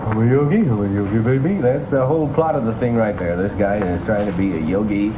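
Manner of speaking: ordinary delivery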